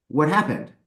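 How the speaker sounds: usual speaking voice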